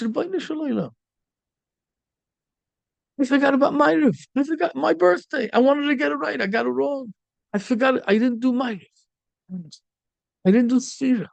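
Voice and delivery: high-pitched, upset plea to God. Retold or imitated. Young man realizes he forgot evening prayer, upset. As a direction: cry out in distress at having forgotten. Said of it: "So he's so upset".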